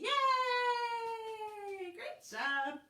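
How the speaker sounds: high, loud cheer. Real, not acted. Teacher cheering the children after the song.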